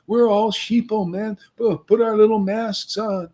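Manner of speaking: high, loud whiny imitation of outsiders; preacher imitating people who object to ecstatic worship, high and whiny; mock complainers.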